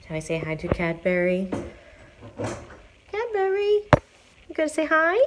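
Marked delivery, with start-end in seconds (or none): 3.1-5.2 much higher coaxing pet talk. Real, not acted. Coaxing a sleepy shelter cat to say hi, in high pet talk. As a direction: coax the cat up in a high sing-song.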